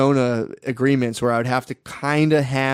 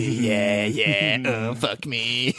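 low, soft, creepy toad; horny toad voice; 3 semitones lower, 4 dB quieter, much slower than usual. Retold or imitated. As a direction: mutter lecherously like a disgusting toad. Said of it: "being a horny, disgusting toad".

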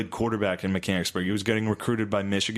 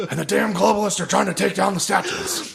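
high, louder caricature voice; 9 semitones higher, 5 dB louder than usual. Pretend. Parodying a right-wing ranter, high mock voice. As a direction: rant it like a cartoon conspiracy guy. Mocking a conservative stereotype.

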